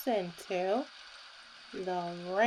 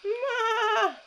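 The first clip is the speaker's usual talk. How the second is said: high, loud bleating Ma; playful goat call; 12 semitones higher, 4 dB louder than usual. Acted. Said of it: "said Goat".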